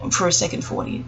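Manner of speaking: whispered aside, soft and breathy; whispered repetition to self. Shown as a demonstration. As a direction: hush it to yourself.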